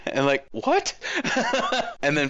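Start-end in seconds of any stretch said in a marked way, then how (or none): 0.5-2.0 very high-pitched baffled squeak; mock disbelief. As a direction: imitate a stunned reaction. Imitates stunned reaction to child caller.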